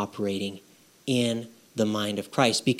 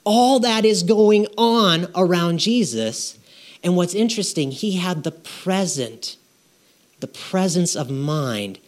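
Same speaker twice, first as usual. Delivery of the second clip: usual speaking voice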